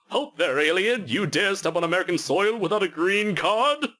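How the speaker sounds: high, brash cartoon superhero bark; hammy patriotic hero commanding; Major Glory voice. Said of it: "line that Major Glory said".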